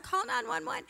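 high-pitched holler, no real volume; mock scream. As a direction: imitate a panicking dad's cry for help. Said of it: "So he just screams out".

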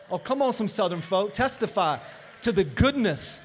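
loud, punched-out revival call; rally the congregation word by word; rousing preaching. Real, not acted.

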